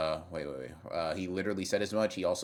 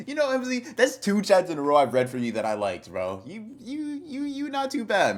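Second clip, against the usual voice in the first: high, loud, squealed approval; excited praise; 13 semitones higher, 8 dB louder than usual. Real, not acted. Streamer praises a chatter he likes, excited. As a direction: gush in a high voice, pleasantly surprised. Praises viewer for liked chats.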